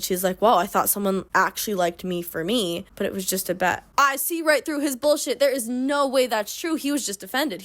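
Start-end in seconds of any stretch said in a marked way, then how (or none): none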